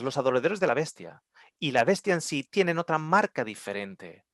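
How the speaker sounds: ordinary talk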